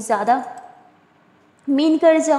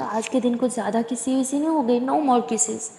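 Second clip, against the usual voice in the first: ordinary delivery, nothing marked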